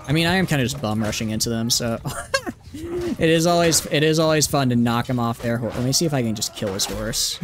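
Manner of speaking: usual speaking voice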